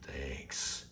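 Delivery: a tiny breathy "Thanks" under the breath; mock whispered thanks.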